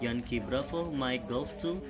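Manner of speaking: calm radio operator call; marine radio. Shown as a demonstration. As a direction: read it like a VHF radio exchange. Radio call demo dialogue.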